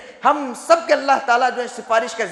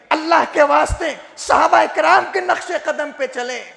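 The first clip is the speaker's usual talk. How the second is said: high, fervent plea; ringing sermon appeal; impassioned preaching; 6 semitones higher, 4 dB louder than usual. Real, not acted. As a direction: implore the congregation with a raised preacher's voice.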